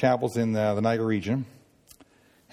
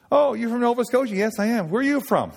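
eager greeting; 10 semitones higher, 3 dB louder than usual. Retold or imitated. Friendly chat between two Maritimers.

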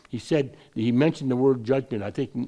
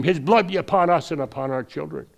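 louder, higher mob cry; retold crowd scream; 4 semitones higher, 6 dB louder than usual. Preacher quoting what the crowd screamed, retold shout. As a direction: call out as the crowd, half shouting. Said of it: "They didn't have to scream".

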